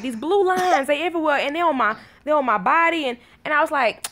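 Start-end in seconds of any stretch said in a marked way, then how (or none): 0.0-3.1 higher, insistent kid imitation; lightly voiced son. Son explains blue lines to mama, mildly worried. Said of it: "he was like".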